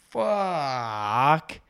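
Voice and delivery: long drawn-out mock groan of a swear word; retold dawning dismay. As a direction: stretch the word out as it sinks in. Retold moment of realising his blunder.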